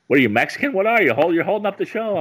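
put-on old comic hurrying a front-row guest, high exasperated yell; Don Rickles ranting. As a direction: push him to answer, losing patience fast. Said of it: "He's like".

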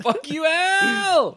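high, slow drawn-out taunt; mock taunting yell. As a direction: stretch it out mockingly.

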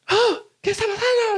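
mock frantic mother, high and whiny; panicked mom. Mother on the phone hearing police arrive, mock frantic. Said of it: "Mi mamá decía".

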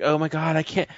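panicky. Retold or imitated. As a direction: imitate squeamish panic. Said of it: "they're like".

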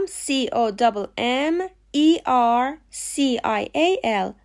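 letters spelled out very slowly; slow spelling. Shown as a demonstration. Teacher spelling COMMERCIAL slowly. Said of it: "لغت دوم".

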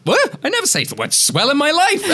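high, squeaky disbelief breaking into laughter; comic outraged disbelief; incredulous. Retold or imitated. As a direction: exclaim in mock horror at yourself. Acts dismay at his own words.

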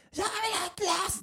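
put-on high, slow whining child demanding ice cream; whiny kid. Dad mimicking his child begging for ice cream, high whine. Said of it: "då bara".

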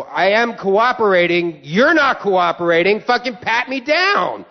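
slow, emphatic retold retort; indignant protest climbing high on the order; exasperated protest. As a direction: stretch each word in mounting irritation. Retold quarrel escalating with screener.

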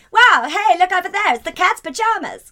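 loud, high roaring-twenties cheer; excited twenties voice. Pretend. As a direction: hype up the jazz age. Setting 1920s scene.

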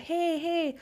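mock jolly regular. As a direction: sing out a chummy hello. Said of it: "he'll come in and be like".

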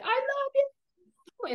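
high, slow, drawn-out mouthed I love you; mock sweet high call; imitating Melissa. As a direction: stretch it way up high like mouthing it from the audience. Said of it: "Melissa's, like, doing her".

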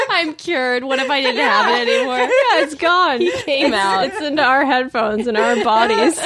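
giggly joking; laughing. Real, not acted. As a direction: joke through giggles.

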